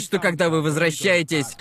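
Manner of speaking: ordinary talk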